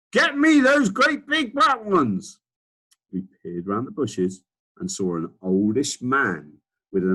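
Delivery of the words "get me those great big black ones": put-on much higher, louder bossy old man; Duke shouting. Old Duke shouting at his gardener, much higher and louder. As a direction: bark orders like a spoiled old duke. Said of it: "the man was shouting".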